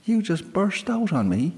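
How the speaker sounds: put-on high, quick young girl; defensive excuse; girl blurting an excuse to the sprite; flustered girl. A narrator's reading.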